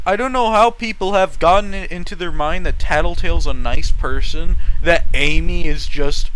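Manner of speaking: loud, heated ranting; angry rant. Real, not acted. Ranting at fans about Amy and Tattletale, loud and angry.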